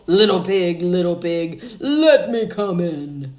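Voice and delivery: the big bad wolf demanding to be let in. Wolf at pig's door.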